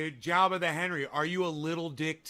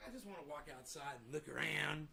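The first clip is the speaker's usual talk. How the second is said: voicing the pet; 14 dB quieter, faster than usual. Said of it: "He's like".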